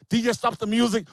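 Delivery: ordinary talk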